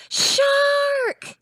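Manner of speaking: high loud frightened cry; shark alarm. Acted. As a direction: shriek it like you've seen a fin. Shark appears in story.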